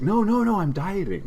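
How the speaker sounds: high, laughing excuse; high-pitched nervous fib; mock high protest. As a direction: squeak out the excuse. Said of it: "He's like".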